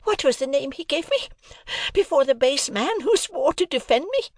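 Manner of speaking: high-pitched outraged cry; blindly furious. A narrator's reading. Sister demands defence from her husband, furious. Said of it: "became blindly furious by regular stages".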